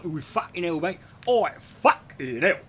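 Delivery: a hammed-up Essex lad swearing; Essex accent. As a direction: Essex accent yelled at a mate. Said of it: "Essex is basically".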